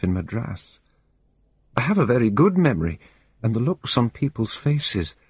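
a nervous woman confiding, steadier now; shaken woman. Acted. Calmer but shaken woman.

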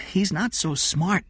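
put-on slow, high child's voice; high-pitched, drawn-out judgement; candid child. Jeff talking about Eddie, candid child.

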